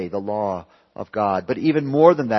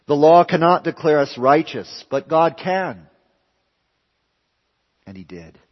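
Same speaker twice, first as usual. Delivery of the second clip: preaching at full force; 4 semitones higher, 7 dB louder than usual. Acted. Preacher contrasting the law with God, full-force preaching.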